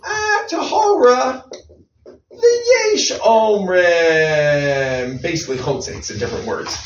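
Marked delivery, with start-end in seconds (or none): 0.0-5.2 high, slow, drawn-out shrug of a ruling; teacher mimicking a lax ruling, slow and airy; mock casual drawl. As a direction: stretch it out like it's no big deal. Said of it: "And over here he says".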